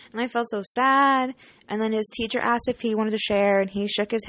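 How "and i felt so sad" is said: ordinary delivery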